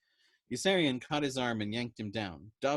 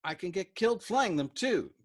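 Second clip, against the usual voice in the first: higher, louder combative retort; pugnacious overwrought; 8 semitones higher, 6 dB louder than usual. A narrator's reading. Dobbs retorting to Yossarian, higher, louder and combative. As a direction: snap back, overwrought. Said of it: "answered pugnaciously in his rough, quavering, overwrought voice".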